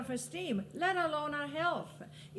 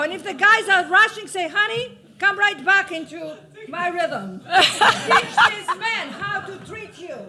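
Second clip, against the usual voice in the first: much louder, laughing through the words; loud laughing advice; 4 semitones higher, 13 dB louder than usual. Real, not acted. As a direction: laugh and call it out to the crowd.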